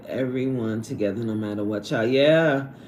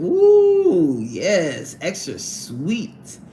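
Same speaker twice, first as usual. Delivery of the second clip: mock savoring; 3 dB louder, much slower than usual. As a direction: savor each word slowly like a treat. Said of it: "they gonna think".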